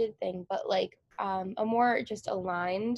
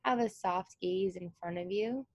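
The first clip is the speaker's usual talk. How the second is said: guided meditation. Acted. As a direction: ease them into stillness with long pauses. Guided meditation begins, eyes closed.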